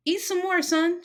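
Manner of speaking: lightly voiced woman urging the boy to eat; motherly Mrs. Jones.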